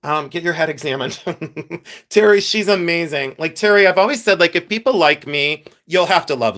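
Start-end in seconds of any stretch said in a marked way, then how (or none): 1.2-2.0 soft, short laugh to oneself; small chuckle. Real, not acted. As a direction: chuckle quietly after your own joke. Laughs at own joke.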